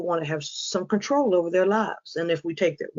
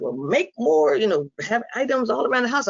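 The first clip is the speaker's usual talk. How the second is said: lightly acted higher-pitched advisor; quoted advice; 7 semitones higher than usual. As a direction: pitch it up a little, passing on a tip. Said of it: "and they said".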